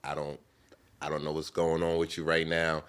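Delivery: soft, tender words to a stranger; gentle consoling. Retold or imitated. Consoling the crying girl in her car, soft and gentle. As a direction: comfort her softly. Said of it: "I said".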